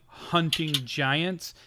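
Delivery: HUNTING GIANTS very slow, set apart, rising at the end; air-quoted HUNTING GIANTS. Acted. Gnome hinting at fight clubs, HUNTING GIANTS said in slow air quotes. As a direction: stretch out HUNTING GIANTS as a knowing euphemism. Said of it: "does the air quotes above him".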